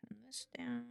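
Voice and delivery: whispering. Real, not acted. Whispering while drawing.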